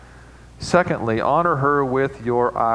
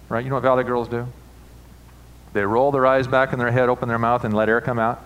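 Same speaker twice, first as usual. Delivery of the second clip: ordinary talk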